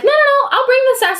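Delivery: high-pitched playful assurance; sassy insistence. Retold or imitated. Replaying her sassy promise to judge.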